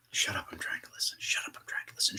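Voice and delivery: soft, fast whispered echo creeping in; eerie whisper. Acted. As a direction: whisper it low and quick like something repeating you. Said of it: "it grew louder and closer".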